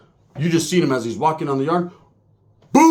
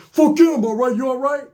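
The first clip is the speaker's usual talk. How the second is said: defensive Uso; 8 semitones higher than usual. Retold or imitated. Uso snapping back at the narrator.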